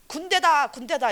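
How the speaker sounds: loud high shout, repeated; shouting demon. Retold or imitated. The demon shouts back at the evangelist, loud and high. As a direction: bellow it out like a defiant spirit. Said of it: "이러는 거예요".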